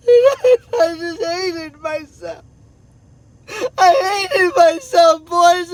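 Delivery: anguished, tearful confession, slow; real crying. Real, not acted. Father confessing self-hatred to his boys, weeping. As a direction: choke out the words slowly through sobs.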